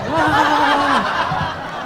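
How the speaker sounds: high, drawn-out cry of shock; startled whoa. Retold or imitated. Guy surprised by the tip, high startled cry. Imagined guy's startled climax.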